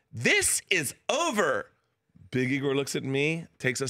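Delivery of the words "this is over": higher, slow, stretched words; mock indignant English teacher; prim teacher. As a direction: drag it out like a stuffy teacher. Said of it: "She's like".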